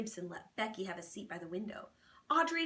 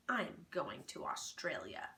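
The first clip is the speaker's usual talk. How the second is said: sulky; 6 semitones lower, 6 dB quieter than usual. Acted. Sulky low slow retort.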